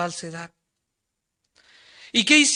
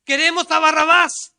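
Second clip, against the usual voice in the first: crowd shouting; 3 semitones higher, 6 dB louder than usual. Retold or imitated. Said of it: "le estaba gritando".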